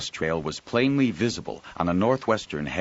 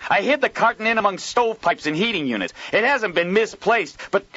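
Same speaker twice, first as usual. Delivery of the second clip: high, loud outraged ranting; furious yelling; 6 dB louder than usual. Acted. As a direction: rage down the phone. Joel furious on phone.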